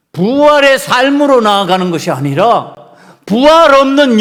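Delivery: loud, high, emphatic sermon voice; full-force preaching. Acted. Preacher warning the congregation, loud and emphatic. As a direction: hammer the warning home to the congregation.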